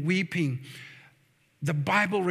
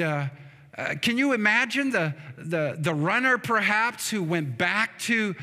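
normal talking voice

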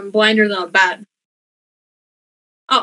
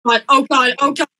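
normal talking voice

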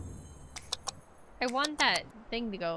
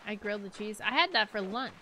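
ordinary talk, nothing marked

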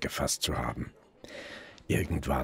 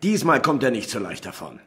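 much higher and louder, determined; sudden burst of resolve, then easing; fired-up order; 9 semitones higher, 9 dB louder than usual. Acted. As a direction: bark the order to bring him in. Detective orders arrest, fired up.

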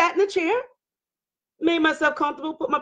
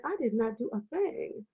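much softer, understated voice; calm, quiet denial; 13 dB quieter than usual. Retold or imitated. Wife answering husband's accusation of acting stupid, quietly calm. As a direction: deny it softly, without any fuss. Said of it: "I was talking just like this".

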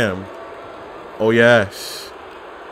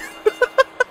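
breathy squeal laugh. Real, not acted.

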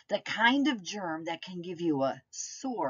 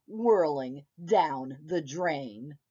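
usual speaking voice

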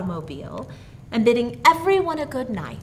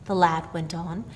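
plain reading voice

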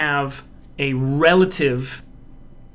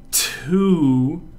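slow demo. Shown as a demonstration. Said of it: "Two. See that?".

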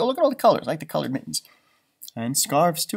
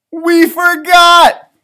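slow, high, loud we forgot; dramatic realization; 13 semitones higher, 12 dB louder, much slower than usual. Acted. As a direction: draw it out in alarm. Sam realizes.

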